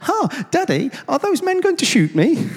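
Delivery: high, gleeful small-child impression; very happy voice. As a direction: squeal it cheerfully like an excited little boy. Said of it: "apparently in a very happy voice".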